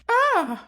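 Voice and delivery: high sharp scream; loud high shriek; startled scream. Acted. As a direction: cry out, caught in the act. Caught snooping by Tiger.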